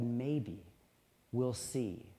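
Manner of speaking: steady and grave, quietly sure; somber, resolute. A narrator's reading. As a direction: somber mother, soft but resolute. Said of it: "somberly yet resolutely said".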